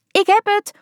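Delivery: high, triumphant cry; thrilled she finally did it; the daughter crowing with delight from the car; elated. A narrator's reading. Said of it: "klonk het opgetogen".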